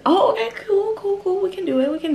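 happy high squeal of relief; excited. Real, not acted. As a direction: cheer as it finally works. Pleased when it works.

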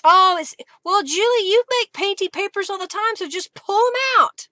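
loud, high-pitched mock exclamation; mock scolding. Crafter addressing Julie about painty papers, mock scolding. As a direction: tell yourself off, high and loud. Playfully voices telling herself off.

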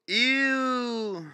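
ordinary talk, nothing marked

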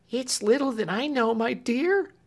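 high, pleading maid's voice; tearful Clemency. A narrator's reading. Clemency weeping and pleading with Marian, high voice. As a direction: beg her through tears. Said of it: "cried Clemency".

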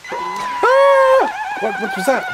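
sudden high yelp of surprise; a real startled cry, very high and loud; startled shout. Real, not acted. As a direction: jump at the alert and shout. Startled by a donation alert.

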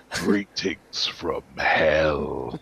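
very slow, low, sinister drawl; actor's villain voice booming down the phone; imitating Pinhead. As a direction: stretch it out like a demon priest. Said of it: "and he's like".

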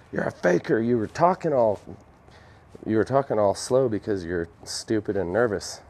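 lightly mocked angry telling-off; Bob Ross. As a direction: play at calling someone out as a fraud. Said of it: "Bob Ross is gonna come back from the dead and beat my ass".

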